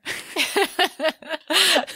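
a high bright laugh; delighted laugh. Real, not acted. Reader laughing at the quarrelling couple. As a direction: burst out laughing at the line. Laughing after reading the quote.

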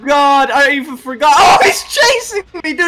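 high, loud terrified screaming; panicked cries while fleeing; being chased. Real, not acted. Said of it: "He's scary".

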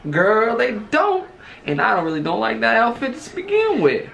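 hammed-up sassy southern accent. Retelling a blunt verdict on an outfit, southern accent. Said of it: "southern accents".

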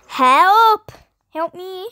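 loud high yell for help; panicked cry for help. Acted. Screaming at the rat.

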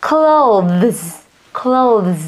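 slow clear demo of Clothes; clothes pronounced. Shown as a demonstration. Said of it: "thì nó sẽ được phát âm như thế này". (phát âm mẫu chậm từ Clothes)

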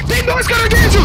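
alarmed. Real, not acted. Two crabs attack.

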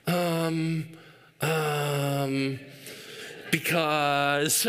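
put-on drawn-out, hesitant little-kid stalling; imitated stalling child. Dad mimicking his son at bedtime, stalling. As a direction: stall for time like a caught kid.

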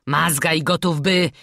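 ordinary delivery, nothing marked

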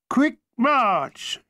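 high shouted parade order; barked command. Acted. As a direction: bark the order. Sergeant's command.

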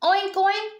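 high, loud oinks; squealing piglet. A narrator's reading. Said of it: "the piglet squealed".

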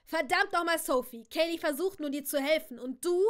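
loud, heated scolding; angry, threatening. Acted. Nash defends Kaylee against Sophie, angry and threatening. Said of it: "Drohend machte er einen Schritt auf sie zu".

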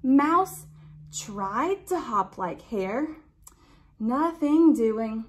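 ordinary delivery, nothing marked

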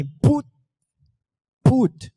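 "Boot. Put." as high, loud kick-drum bursts; beatbox pronunciation. Shown as a demonstration. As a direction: pop the first consonant of "Boot" and "Put" hard. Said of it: "now say it with me".

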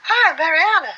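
sing-song the greeting high. Pretend. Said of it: "if [name] looked like this".

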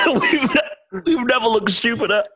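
laughing through the words; giggling helplessly while talking. Real, not acted. Laughing through pepper challenge.

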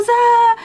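hammed-up scream; mock scream. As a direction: scream stuck in the dark. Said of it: "todos nos quedamos".

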